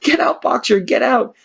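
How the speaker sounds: high urgent calling; chorused cry. Acted. The animals join Clover's cry to Boxer, urgent high call. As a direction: urge him out together. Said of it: "took up the cry".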